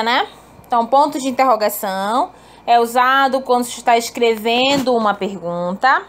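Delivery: usual speaking voice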